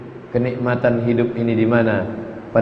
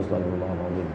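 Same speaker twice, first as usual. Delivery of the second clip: much softer, lower murmur; fading istighfar; 6 semitones lower, 9 dB quieter, much faster than usual. Shown as a demonstration. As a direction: let it fade almost to nothing. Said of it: "Yang istighfar".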